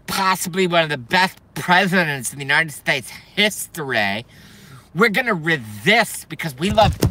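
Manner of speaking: lisping, swooping parody voice; mock soy boy. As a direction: stretch the words in a lispy caricature. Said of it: "hard to maintain this character".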